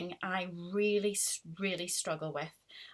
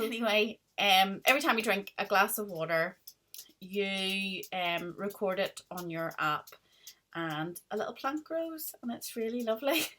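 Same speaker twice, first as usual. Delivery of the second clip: usual speaking voice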